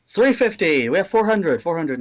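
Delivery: milk each bid like an auctioneer; auctioneer calling bids. Acted. The half-orc auctioneer calls out rising bids, loud.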